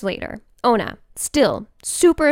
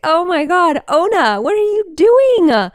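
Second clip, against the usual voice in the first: louder, higher cry of disgust; horrified shock; 9 semitones higher, 6 dB louder than usual. Retold or imitated. Owner shrieks at the dog covered in whale guts. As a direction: recoil at the stinking dog. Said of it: "And I'd be like".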